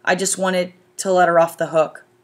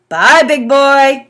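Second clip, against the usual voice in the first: fond goodbye; 7 semitones higher, 8 dB louder, much slower than usual. A narrator's reading. Dad to son.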